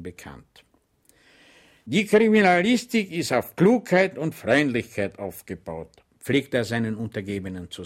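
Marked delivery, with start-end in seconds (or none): 1.9-6.0 higher, louder instructive sergeant; police sergeant lecturing. Acted. Sergeant lecturing his subordinates, higher and louder. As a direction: preach it to your men.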